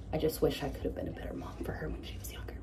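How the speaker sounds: sad whisper. Real, not acted. Regret about past mothering.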